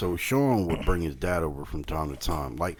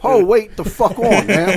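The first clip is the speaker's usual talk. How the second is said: slow, loud, much higher shout; shocked yell; 12 semitones higher, 12 dB louder, slower than usual. Retold or imitated. Seeing the kids fake-drinking, slow shocked yell. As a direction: stop them cold, alarmed.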